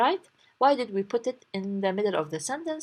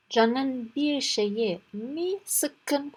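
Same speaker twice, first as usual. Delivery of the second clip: slow demo; 3 semitones higher, much slower than usual. Shown as a demonstration. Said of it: "We said here".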